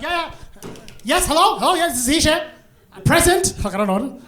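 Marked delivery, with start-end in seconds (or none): 0.0-2.4 higher flustered hello; flustered answering. Retold or imitated. Rushing to the laptop, answering the manager, flustered and high. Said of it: "I'm like". 3.0-3.5 high loud call; Present!. Retold or imitated. Answering the HR manager, loud high call. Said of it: "answering roll call".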